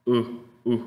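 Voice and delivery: short "u" with little articulation; lax U sound. Shown as a demonstration. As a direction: drop a brief relaxed "u". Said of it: "sino es nada más".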